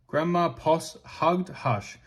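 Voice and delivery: ordinary delivery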